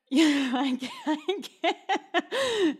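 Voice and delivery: high, loud words breaking into real laughter; can't get it out for laughing; helpless laughter. Real, not acted. Host cracks up reading explicit messages, helpless laughter.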